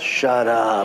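low, firm command; a hint of God cutting in, low; lightly voiced God. God stopping the preacher in his tracks, low command. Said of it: "God says".

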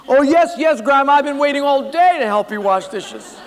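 high, loud fake enthusiasm; trapped boy faking delight; forced eagerness. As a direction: overdo the cheerful yes.

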